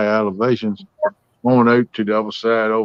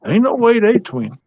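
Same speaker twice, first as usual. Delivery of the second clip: high-pitched imitated disbelief; incredulous onlooker; 9 semitones higher, 4 dB louder, slower than usual. Onlooker reacting to twins who look nothing alike, incredulous. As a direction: scoff in a raised voice like a doubting stranger. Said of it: "you'd look at them and say".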